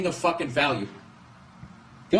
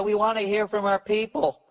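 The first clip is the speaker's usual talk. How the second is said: higher, mock-sincere official; 7 semitones higher than usual. Politicians pretending to listen to protesters, higher mock voice. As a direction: parody a politician faking concern. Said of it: "they act like".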